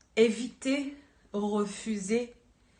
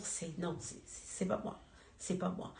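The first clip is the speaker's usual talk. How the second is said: retold inner refusal, much softer; muttered self-denial; 11 dB quieter than usual. Speaker recalling refusing what the Eternal showed, murmured denial. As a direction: murmur it as if to yourself. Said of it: "Parce que je me disais".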